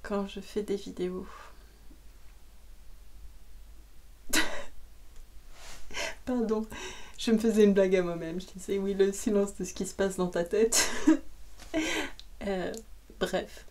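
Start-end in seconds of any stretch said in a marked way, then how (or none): 1.9-6.2 breathy, mostly voiceless laugh. Real, not acted. Laughing at a joke she made to herself. 10.9-12.4 high little laugh. Real, not acted. Amused at her own joke, laughing.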